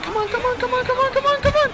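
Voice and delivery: rising, high, urgent chant; frantic cheering. Real, not acted. Cheering the final stretch.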